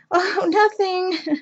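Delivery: drawn-out, innocent "oh, nothing"; coy evasion. Retold or imitated. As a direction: slowly play dumb so the kids don't find out. Dodging kids' question, laughing.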